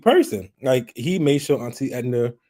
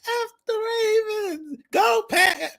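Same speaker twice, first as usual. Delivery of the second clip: high, shrill-pitched mock yell of a rival fan; yelled team taunt; slower than usual. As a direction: scream it out the car window like a fan. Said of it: "She winds down my window and yell out".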